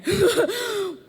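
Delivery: high, loud laugh. Real, not acted. Laughing over the doubled budget, high and loud. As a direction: burst out laughing.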